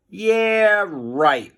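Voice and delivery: mocking, disbelieving scoff; sarcastic. A narrator's reading. Harold scoffs at Melvin with a sarcastic laugh. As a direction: brush it off with a sneer. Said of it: "laughed Harold".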